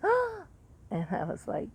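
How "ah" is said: very high shocked gasp imitated; a sharp imitated gasp of shock. Nurse assistant gasping at the patient's accusation. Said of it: "his nurse assistant was like".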